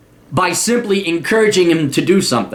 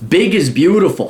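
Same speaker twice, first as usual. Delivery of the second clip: ordinary delivery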